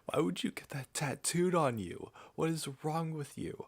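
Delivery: high, disbelieving question; baffled at the tattoo, pitch way up; incredulous. Real, not acted. Reacting to a maths-formula tattoo, high incredulous.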